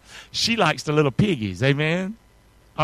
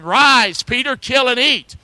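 raised, higher voice of command; commanding heavenly voice; 5 semitones higher, 6 dB louder, slower than usual. Acted. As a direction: proclaim it loudly as an order from heaven.

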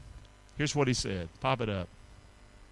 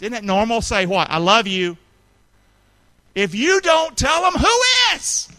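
higher, louder climbing cry; preaching at full force; 8 semitones higher, 10 dB louder than usual. Real, not acted. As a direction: build to a ringing peak. Preacher builds to a shout.

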